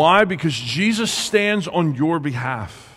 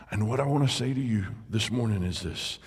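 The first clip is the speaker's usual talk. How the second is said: normal talking voice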